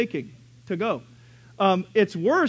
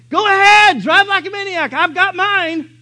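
much higher, louder mocking yell; dad yelling; 12 semitones higher, 12 dB louder than usual. Dad yelling at passing drivers, loud and gloating. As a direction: yell it out the window at speeders. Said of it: "my dad would always say".

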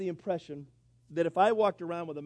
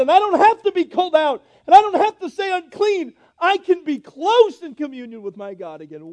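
louder and higher, building to a fervent climax; preaching at full force; 10 semitones higher, 14 dB louder than usual. Acted. Preacher to the congregation, building to a loud, fervent climax. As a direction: preach it out, rising with every clause. Preacher shouting the leper's joy.

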